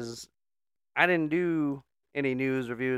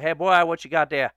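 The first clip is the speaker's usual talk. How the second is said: loud, higher Southern holler; yelled out; 4 semitones higher, 8 dB louder than usual. Acted. Old man calling to the passing boy, yelled. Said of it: "Old man yells out".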